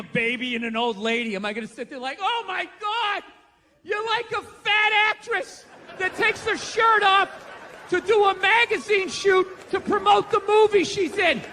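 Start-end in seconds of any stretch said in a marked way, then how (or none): 2.2-11.4 high, slow gushing, building to a laughing shout; mock gushing praise. Praising a heroic fireman like an actress, mock gushing. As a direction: overdo the awe until it breaks into laughter.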